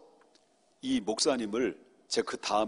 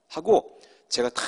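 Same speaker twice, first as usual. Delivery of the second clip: ordinary talk, nothing marked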